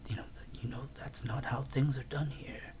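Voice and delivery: soft, hushed whisper; husband whispering an explanation to his wife. Retold or imitated. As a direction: whisper it as a quiet aside. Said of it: "Algernon just goes".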